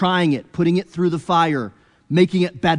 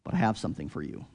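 much softer, low and hushed as God pleading; gentle entreaty; 6 semitones lower, 11 dB quieter, faster than usual. Acted. God softly entreating Abraham, low and hushed. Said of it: "with entreaty to Abraham".

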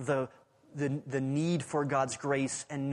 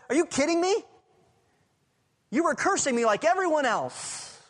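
much higher, louder, scoffing disbelief; mock incredulous; 11 semitones higher, 5 dB louder than usual. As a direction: sound as if you can't believe the nerve. Imagined Jesus scoffing at the thief.

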